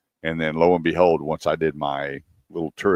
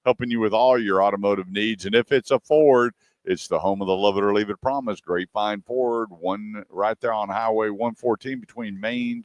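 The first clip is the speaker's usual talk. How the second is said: high-pitched, punchy sponsor plug; radio ad read; 5 semitones higher than usual. Acted. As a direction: sell it like a radio announcer, drawing out the names.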